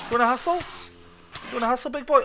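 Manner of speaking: mock taunt. Taunting a mob in game.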